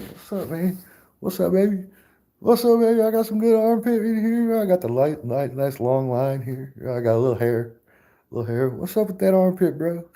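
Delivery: high, put-on flirty voice showing off an armpit; hammed-up armpit flirt; flirty armpit guy. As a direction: play a guy bragging about his armpit like a pickup line. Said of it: "I mean, like".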